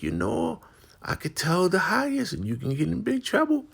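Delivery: high put-on voice; warning Marva. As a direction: caution sternly. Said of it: "She's like".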